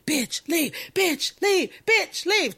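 mock chant swelling until the whole store yells; rising to a yell. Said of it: "everybody ... is just screaming".

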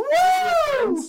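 high, loud cheering whoop; excited whoop. Real, not acted. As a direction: let out a real whoop of joy. Cheering over the Legoland gift.

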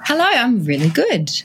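ordinary talk, nothing marked

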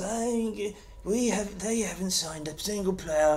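high, soft mock pundit voice. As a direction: sneer like a smug pundit. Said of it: "they said it exactly like that".

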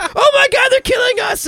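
very high, loud scream; mock panicked victims. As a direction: shriek like movie victims under attack. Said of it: "and they're like".

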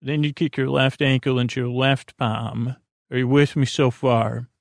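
ordinary talk

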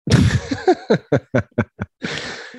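high, unvoiced belly laugh; burst of laughter. Real, not acted. Co-host reacting to the resignation sign-off, bursting out laughing. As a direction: crack up in a long run of laughs.

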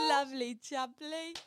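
drawn-out joking cockney; mock cockney accent. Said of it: "That accent is something".

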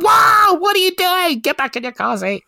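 a high, startled parent shriek; mock alarmed yell. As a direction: yell at a kid who has climbed out of her car seat. Dad yelling at child in car.

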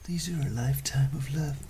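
hippie whisper. Acted.

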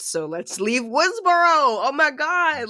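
high, loud, drawn-out mock thrill; mock excitement. Podcaster parodying filmmakers fleeing to a new setting, mock excited. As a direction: send up an over-hyped pitch. Mocks sequel makers' sellout idea.

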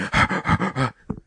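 quick mock labour breaths; imitated panting. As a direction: pant like a woman in labour. Imitates panting woman grabbed by midwife.

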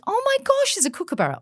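high, breathless excited Queen; lightly posh royal delight; mock Queen. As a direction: squeal it like a thrilled Queen. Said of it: "she says it posher than that".

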